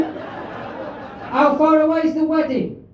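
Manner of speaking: slow, drawn-out yelled question; yelling as if far away. Real, not acted. Heckled comic repeating the wedding question, slow and drawn out. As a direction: call it slowly across a great distance. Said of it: "How far away do you think I am?".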